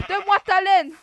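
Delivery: mock demand. Demanding wool from sheep.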